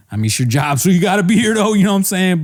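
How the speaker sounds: mock host opening a meeting; Andrew impression. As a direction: rise into an insistent, high-pitched pitch. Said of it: "And then Andrew's like".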